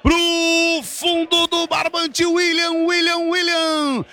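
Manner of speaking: screaming goal call, rising pitch; explosive goal commentary. Real, not acted. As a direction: yell the goal with everything. Live goal call, scoring moment.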